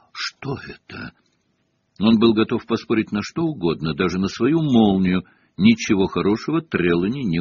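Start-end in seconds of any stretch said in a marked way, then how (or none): 0.1-1.1 slow, breath-only mysterious whisper. A narrator's reading. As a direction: whisper it slowly, peering into the unknown. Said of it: "прошептала профессор, приблизив лицо к шару". (медленный таинственный шёпот)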